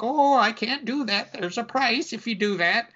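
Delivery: put-on high-pitched old witch lady, reluctant. Witch refuses the burglar's demand, high old-lady voice. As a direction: protest in a high old-woman voice. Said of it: "She's like".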